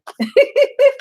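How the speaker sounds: a sudden high burst of laughter. Real, not acted. Laughs at her own joke.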